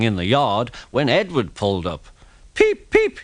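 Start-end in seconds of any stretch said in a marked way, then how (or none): 2.5-3.2 very high, loud engine whistle; whistle peep. Acted. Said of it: "he whistled".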